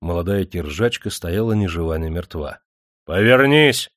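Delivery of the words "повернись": loud, raised shout; high, forceful order; shouted command. A narrator's reading. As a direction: shout it so she flinches. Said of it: "прикрикнул хозяин так".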